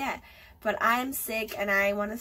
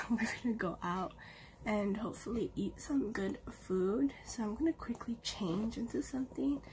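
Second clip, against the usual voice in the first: whispering; 4 dB quieter than usual. Real, not acted. Whispering unvoiced.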